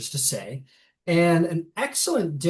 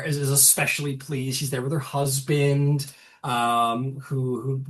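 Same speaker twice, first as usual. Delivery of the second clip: ordinary talk, nothing marked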